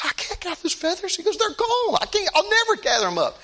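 high, pained voice of a sorry man; mock-anguished high plea; despairing cry. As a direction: wail it as a man who sees it's hopeless.

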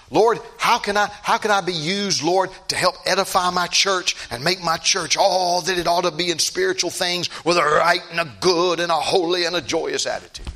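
loud, high impassioned preaching; preaching at full force. Acted. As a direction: lift it to a fervent plea. Preacher's impassioned prayer-plea.

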